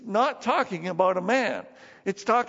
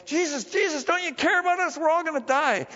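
acted high-pitched panic; freaking out; 9 semitones higher, 4 dB louder than usual. Disciples beg Jesus in the squall, acted panic. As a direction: plead frantically in a raised pitch, playing terrified followers. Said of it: "the disciples are freaking out".

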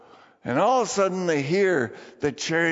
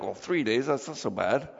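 low, quieter mock shrug in the voice; unimpressed listener; 8 semitones lower, 4 dB quieter than usual. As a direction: imitate someone casually playing it down.

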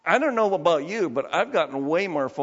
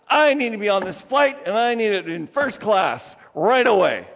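loud, pushy demand; demanding passenger; 5 semitones higher, 5 dB louder than usual. Retold or imitated. Angry passenger demanding a first-class seat, loud and pushy. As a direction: bark it at the clerk like you own the place. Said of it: "slaps down his ticket and says".

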